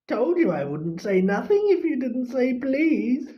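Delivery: high, cackling triumphant ghost; high mocking shriek then a gleeful laugh; gloating poltergeist. A narrator's reading. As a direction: crow over Filch with a high cackle. Peeves taunting Filch.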